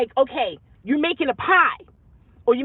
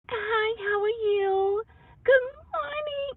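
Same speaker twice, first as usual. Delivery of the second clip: high squeaky cartoon mouse voice, much higher; Minnie Mouse voice; 9 semitones higher, 5 dB quieter, slower than usual. Sister with sore throat greeting people like Minnie Mouse. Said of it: "Sound like Minnie Mouse".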